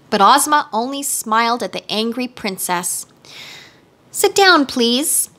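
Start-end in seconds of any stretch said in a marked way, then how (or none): none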